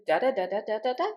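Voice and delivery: quick "da da da" climbing at the end; wordless rising intonation on DA; rising question tune. Shown as a demonstration. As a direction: hum out a question's melody in da syllables, last "da" lifted. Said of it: "the voice goes up".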